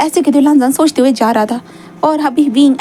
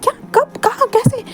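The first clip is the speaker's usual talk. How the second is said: much higher, rapid disbelief; shocked questions; 12 semitones higher, slower than usual. A narrator's reading. Friend hears they moved into his flat, stunned and high-pitched. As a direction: squeak out each question in shock.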